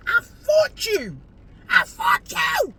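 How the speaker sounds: high, slow, shouted "I fought you"; comic shouting about the shower argument; mock outrage. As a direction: stretch it out in a hammed-up yell. Mock outrage at imagined fight.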